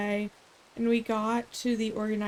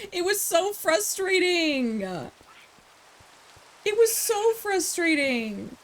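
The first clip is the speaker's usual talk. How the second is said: exasperated; 6 semitones higher than usual. Real, not acted. Venting about a show just finished.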